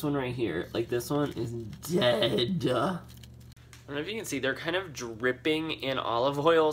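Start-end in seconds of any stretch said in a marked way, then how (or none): none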